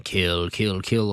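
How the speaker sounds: lightly voiced sinister house, chanting low; haunted house voice. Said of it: "It's saying".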